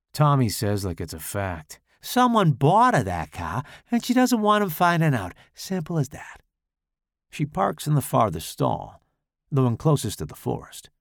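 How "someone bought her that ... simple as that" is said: smug know-it-all. Acted. Tommy explains smugly.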